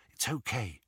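soft, calm reassurance; reassuring. Acted. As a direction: gently soothe a scared friend. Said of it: "said Harry reassuringly".

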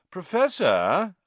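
high, slow, loud drawl. A narrator's reading. Malfoy summoning his teacher to complain, drawling. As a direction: drawl it out, rising at the end. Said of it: "drawled Malfoy".

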